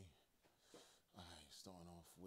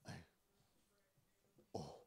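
normal talking voice

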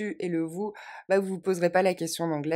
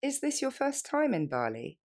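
faster; 6 semitones higher than usual. Shown as a demonstration. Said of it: "Plus rapidement".

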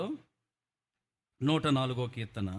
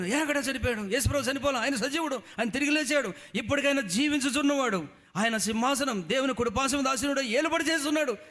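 high, loud ringing declaration; loud high-pitched triumphant preaching; triumphant proclaiming; 12 semitones higher, 5 dB louder than usual. Acted. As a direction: proclaim it like a victory. Luther proclaims Jesus alive.

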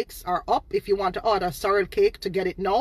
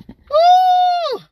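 long high-pitched shouted "ooh" of dismissal; loud high whoop; 8 dB louder than usual. Real, not acted. Dismissing the fake page.

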